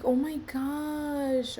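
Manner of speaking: drawn-out awed gasp of words; stunned, slow. Real, not acted. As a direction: let it sink in slowly. Stunned by three years' silence.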